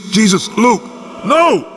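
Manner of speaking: sharp frightened shout; alarmed cry. Acted. Michael shouting at his brother in alarm. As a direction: cry out in horror.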